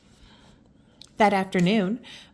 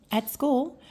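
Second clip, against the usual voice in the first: usual speaking voice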